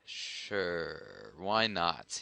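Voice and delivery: ordinary delivery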